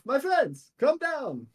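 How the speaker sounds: high, hearty call to someone overhead; Demos calling up. Acted. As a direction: call up to friends on a roof. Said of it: "he looks up onto the roof".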